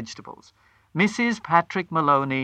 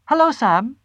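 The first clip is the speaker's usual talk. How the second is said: high, bright, louder hello; bright greeting; 10 semitones higher, 6 dB louder than usual. A narrator's reading. Wife rehearsing her greeting to the grocer, bright and high. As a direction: put on a cheery rehearsed voice. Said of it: "she said brightly".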